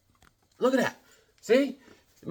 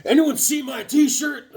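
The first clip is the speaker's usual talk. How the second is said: much higher, slow silly voice; voicing drawn guy; 11 semitones higher, 4 dB louder than usual. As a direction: ask like a lost hero. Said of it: "he's like".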